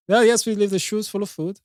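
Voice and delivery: put-on high, hammy German accent; comedy German accent. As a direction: play up a silly German accent. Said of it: "my comedy German accent".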